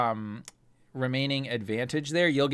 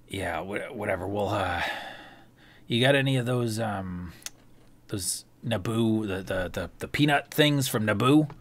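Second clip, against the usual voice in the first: Ander voice; 3 semitones lower than usual. Acted. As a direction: murmur it like a cagey card player.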